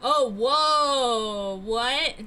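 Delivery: loud startled gasp; surprised. Real, not acted. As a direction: jolt in surprise. Shock at someone in the road.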